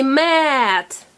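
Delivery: "mat" with a long vowel; said slowly. Shown as a demonstration. Teacher modelling a word for a child, said slowly.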